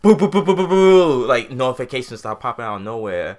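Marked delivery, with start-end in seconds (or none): 0.0-1.2 loud, high "boo boo" beeps like a phone going off; imitated notification pings. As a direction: rattle off the alert noises.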